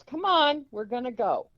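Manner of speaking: pushy retold coaxing; imitated insistent mother. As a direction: act out a mother pulling someone along. Mother urges daughter to come along.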